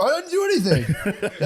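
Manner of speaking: high, slow mock-innocent protest with a laugh. Spouse denying hurting partner, high mock-innocent whine. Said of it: "And you'll go".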